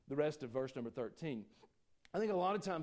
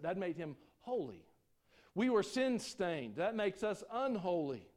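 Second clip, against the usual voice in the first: higher, louder pulpit preaching; preaching at full force; 5 semitones higher, 5 dB louder than usual. Acted. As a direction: preach it hard to the congregation. Preaching on atonement.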